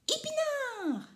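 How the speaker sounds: very high, slow drawn-out cry; excited cry. A narrator's reading. Rikili crying out his answer, very high and slow. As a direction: shout the discovery triumphantly. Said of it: "il s'écrie".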